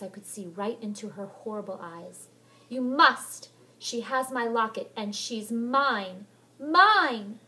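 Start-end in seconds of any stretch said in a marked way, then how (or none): 2.7-7.4 loud, high, possessive shriek; ghostly furious shout. Acted. Ghost Helen shrieking at Molly to keep Heather. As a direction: scream that she belongs to you.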